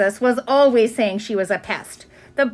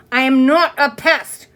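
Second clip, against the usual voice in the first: louder, slower firm protest; indignant; 2 semitones higher, 5 dB louder than usual. Acted. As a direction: insist on it indignantly. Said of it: "said Ramona indignantly".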